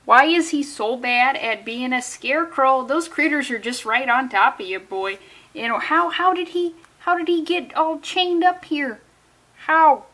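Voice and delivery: a put-on Milwaukee accent, strong at the start; a hammed-up Milwaukee accent. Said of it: "That was a terrible Milwaukee accent, but I feel like I, I started strong".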